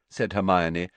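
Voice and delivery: usual speaking voice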